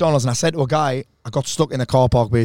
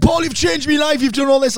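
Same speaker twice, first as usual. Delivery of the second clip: grateful fan voice; 11 semitones higher than usual. Retold or imitated. Grateful audience member quoted.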